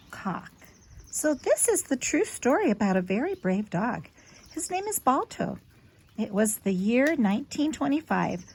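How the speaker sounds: gentle storybook read-aloud; clear, expressive storyteller voice for a little one; reading to a child. Acted. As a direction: read it like a bedtime picture book. Reads picture book to child.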